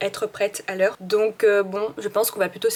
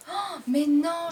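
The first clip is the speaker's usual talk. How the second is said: surprised; 8 semitones higher, much slower than usual. Real, not acted. Notices something in the bag.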